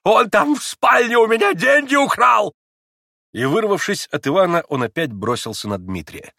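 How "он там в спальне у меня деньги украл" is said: high, loud frantic screech; old man's hysterical high yell; squealing accusation. A narrator's reading. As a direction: shriek it as an alarmed accuser. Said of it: "завизжал Фёдор Павлович".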